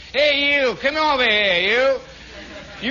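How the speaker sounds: loud, high Southern holler; drawn-out Southern call across the room; Southern accent. Acted. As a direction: call someone over in a hammed-up Southern accent. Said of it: "how many Southern accents there were".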